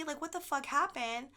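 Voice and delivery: ordinary talk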